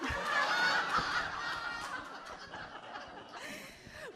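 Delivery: long high burst of laughter; helpless high laugh; big real laugh. Real, not acted. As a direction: crack up at the punchline. Laughs at own story.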